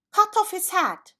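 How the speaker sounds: high, loud royal command; furious shout. A narrator's reading. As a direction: bellow the order at full force. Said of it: "cried the Queen".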